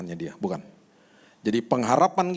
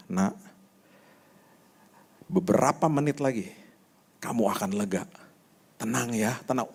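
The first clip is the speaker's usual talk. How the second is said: soothing father. Retold or imitated. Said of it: "saya bilang ini".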